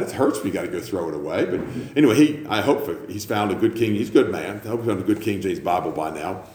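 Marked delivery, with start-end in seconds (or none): none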